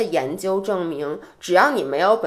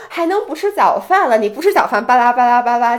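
nagging parent; 3 semitones higher, 7 dB louder than usual. Retold or imitated. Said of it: "你父母就说".

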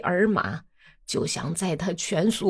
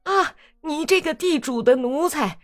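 higher, jeering shout; loud clamour; 7 semitones higher than usual. A narrator's reading. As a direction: yell the accusation together. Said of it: "哇啦哇啦的大声嚷". (大声嚷嚷)

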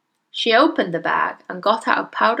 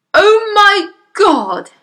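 slow, high wail of horror; yelling father; 11 semitones higher, 9 dB louder, much slower than usual. Acted. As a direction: yell it drawn out, aghast. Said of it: "yelled the father".

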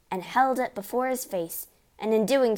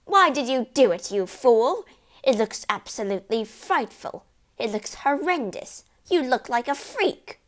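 raised, appalled mother's scolding; shrieking outrage; 4 semitones higher, 6 dB louder than usual. A narrator's reading. Mother berating husband for his hair, shrieking. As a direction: berate him in horrified outrage. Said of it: "shrieked the mother".

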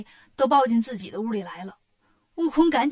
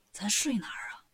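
softer hushed question; quietly asking; 3 semitones lower, 7 dB quieter than usual. A narrator's reading. Tang Seng quietly asking Wukong, hushed. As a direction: ask so only Wukong hears. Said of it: "唐僧悄悄问悟空".

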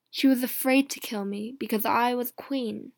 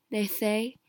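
plain reading voice

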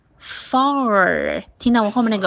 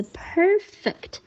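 PER-fect, first syllable held; stress on first syllable; 10 semitones higher than usual. Shown as a demonstration. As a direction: model "perfect" with weight on PER. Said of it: "一般会在第一个音节重读".

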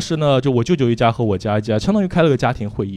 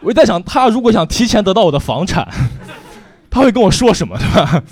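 higher and louder with laughter; laughing setup; 8 semitones higher, 7 dB louder than usual. Real, not acted. 向观众铺垫笑话，边笑边说. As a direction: build the joke giggling.

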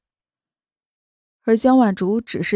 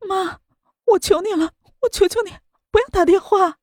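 high, tearful begging again; crying plea; 9 semitones higher than usual. A narrator's reading. Daughter kneeling to beg her mother, crying. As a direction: plead desperately through sobs, much higher.

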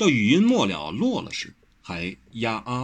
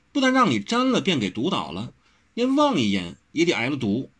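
put-on a lightly raised girlish voice, bragging; young woman, proud and teasing; 6 semitones higher than usual. A narrator's reading. Wen Rou teasingly boasts of her father to Wang Xiaoshi, lightly higher voice. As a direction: brag about your father's poisons, coy and quick.

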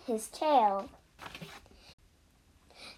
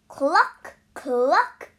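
short, rising clucks; chicken clucking; 2 semitones higher, 5 dB louder than usual. A narrator's reading. Chicken just woken up in a story, perky clucking. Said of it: "said Chicken".

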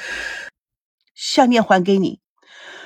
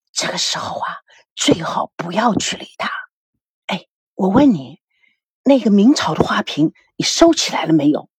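hushed voice; 2 semitones lower than usual. A narrator's reading. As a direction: whisper it, keeping the voice down. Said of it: "孙正尽量将声音放小". (丈夫压低声音悄悄问妻子)

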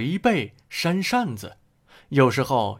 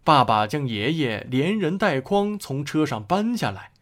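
plain reading voice

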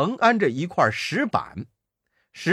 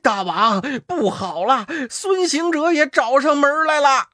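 慌张; 8 semitones higher, 3 dB louder than usual. Acted. 先锋慌忙报告.

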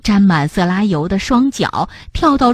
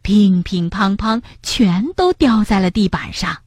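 plain reading voice

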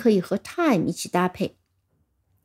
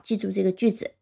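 ordinary delivery

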